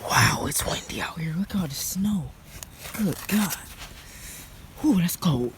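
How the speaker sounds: hushed whisper in the wind. Real, not acted. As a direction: whisper so the neighbours won't hear. Whispering out in the storm.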